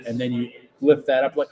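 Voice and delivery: ordinary talk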